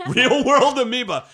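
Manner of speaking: high, loud, through laughter; laughing repeat. Real, not acted. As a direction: echo the joke while cracking up. Cracks up repeating the joke.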